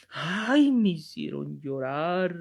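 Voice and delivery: slow, drawn-out mock whimper of a moved churchgoer; lightly hammed-up tearful sigh; mock weepy. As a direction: play up the tears, slow and sentimental. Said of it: "con decir".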